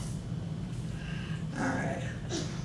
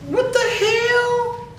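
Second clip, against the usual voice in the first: shocked; 9 semitones higher, much slower than usual. Real, not acted. Touch guessing game, shocked.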